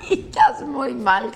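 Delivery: high burst of laughter; laughing. Real, not acted. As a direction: laugh out loud. Big laugh at cohost.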